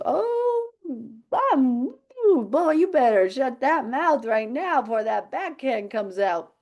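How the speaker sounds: high, slow, hammed-up warning; mock stern dad. Husband warns the son, in a mock stern voice. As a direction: play the threatening father for laughs. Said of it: "He goes".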